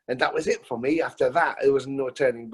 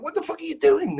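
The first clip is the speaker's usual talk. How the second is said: mock angry shout. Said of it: "someone came running across and went".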